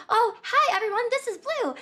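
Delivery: high, cheery greeting; bubbly host voice. Retold or imitated. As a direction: chirp it like a sunny host. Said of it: "she's like".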